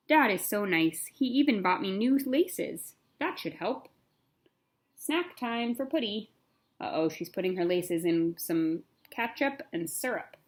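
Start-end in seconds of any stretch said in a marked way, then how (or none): none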